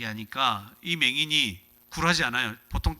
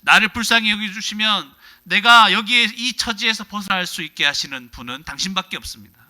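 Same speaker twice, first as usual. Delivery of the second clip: desperate cry; 6 semitones higher, 8 dB louder than usual. Acted. Blind man's desperate cry.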